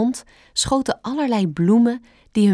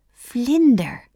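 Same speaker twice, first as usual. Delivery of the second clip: put-on higher, childlike exclamation; Bambi calling out; 4 semitones higher, 3 dB louder than usual. A narrator's reading. Fawn naming yellow flowers, calling out. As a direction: call out the newly learned word. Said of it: "riep hij".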